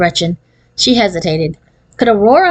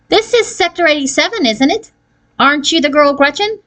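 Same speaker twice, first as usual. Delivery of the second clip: anxious; 8 semitones higher than usual. A narrator's reading. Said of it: "There was a note of anxiety in her voice".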